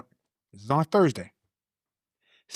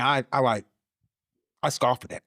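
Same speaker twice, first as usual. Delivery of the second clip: ordinary talk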